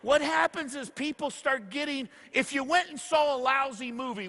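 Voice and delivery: ordinary talk, nothing marked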